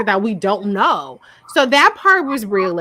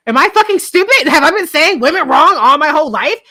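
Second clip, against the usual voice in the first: loud, high-pitched incredulous outburst; worked-up retold rant; exasperated self-questioning; 6 semitones higher, 7 dB louder than usual. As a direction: push it up high and loud, reenacting disbelief. Retells her exasperated self-questioning.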